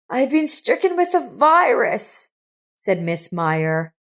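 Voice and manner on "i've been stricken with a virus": put-on higher, frail sick old lady voice; sick old woman. A narrator's reading. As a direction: speak as a feverish old woman. Miss Meyer's voice, sick.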